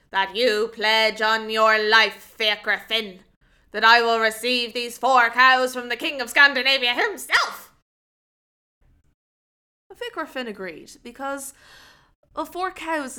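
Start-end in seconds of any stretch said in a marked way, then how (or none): 0.1-7.7 put-on stern old woman. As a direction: lay down the condition firmly. Old woman sets condition.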